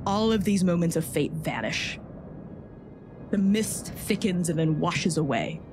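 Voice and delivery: ordinary delivery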